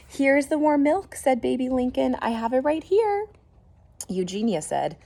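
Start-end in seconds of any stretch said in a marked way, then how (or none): 0.1-3.3 high, loud and bright; eager Baby Lincoln. Acted. Younger sister bringing milk for the piglet, eager. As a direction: rush in with the milk, thrilled.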